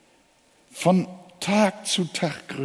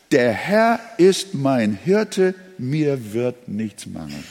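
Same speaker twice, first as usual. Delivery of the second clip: the whole verse read slowly and evenly; slow full verse; 4 semitones lower, slower than usual. Shown as a demonstration. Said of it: "Der gesamte Vers heißt".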